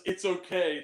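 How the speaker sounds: usual speaking voice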